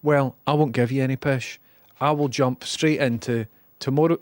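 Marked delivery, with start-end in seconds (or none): none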